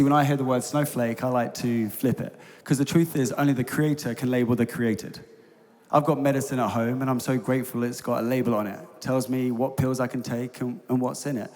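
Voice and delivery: normal talking voice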